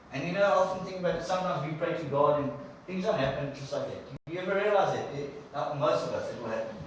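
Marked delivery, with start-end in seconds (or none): none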